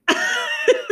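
high giggle. Real, not acted. Laughing at weird show.

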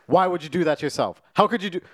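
mock a mother's outraged questioning; upset mom.

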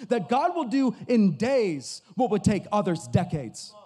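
loud revival preaching; full-force preaching. Real, not acted. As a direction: preach it out, building to a roar. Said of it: "I'm already preaching".

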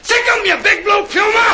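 high laughing shout; shouting command. Acted. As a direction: yell it at the puma.

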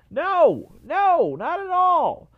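high, loud scoffing no; incredulous no. Real, not acted. Scoffing fan answering his own rhetorical question, incredulous. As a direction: shoot the idea down with disbelief.

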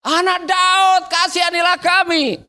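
high, insistent cry for mercy; shouting out. Acted. Beggar ignoring the crowd, shouting high for mercy. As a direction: keep calling, pitched high. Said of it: "Dia tetap berteriak".

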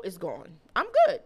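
ordinary talk, nothing marked

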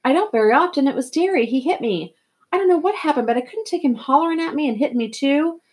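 high-pitched, distressed outpouring; hurt, agitated confession; upset. A narrator's reading. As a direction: explain it while still shaken from crying. Upset boy explains the slap.

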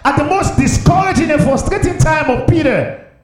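high, shouted preacher's delivery; full-force preaching. Real, not acted. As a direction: drive it home loudly from the pulpit.

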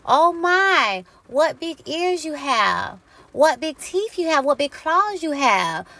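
put-on high, wide-eyed storybook girl, slow on the big words; hamming up the wondering girl at grandma's bed. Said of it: "what did she say?".

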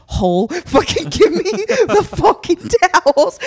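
high-pitched outburst breaking into laughter; hammed-up rage through laughs; retold furious demand. Guest demanding towels from clerk, laughing mock fury.

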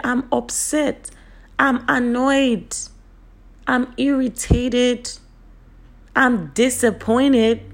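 name each feeling slowly and emphatically; deliberate declarations. Shown as a demonstration. Models naming feelings out loud one by one.

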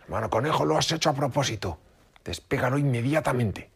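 high, accusing fox; angry accusation. A narrator's reading. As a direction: demand it, outraged. Stuck fox accuses rabbit.

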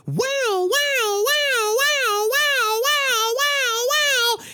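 alarm siren made with the voice, very high; high siren wail for the alarm going off; imitated alarm. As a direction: mimic a wailing warehouse alarm.

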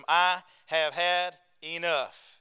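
slow, weighty stress on every word of "I have had enough"; with emphasis. Retold or imitated. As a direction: draw out "I have had enough", landing firmly on "enough". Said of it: "Says it with emphasis".

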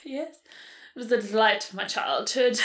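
put-on light British accent, fond memory; a British lady remembering, lightly put on. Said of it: "You sound like someone off of Call the Midwife".

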